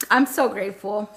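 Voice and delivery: ordinary talk, nothing marked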